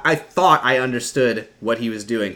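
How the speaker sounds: ordinary talk, nothing marked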